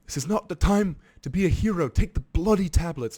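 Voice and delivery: a brisk Irish doctor telling him off; Irish accent. Retold or imitated. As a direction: do the Irish psychiatrist, firm and loud. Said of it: "in her Irish accent".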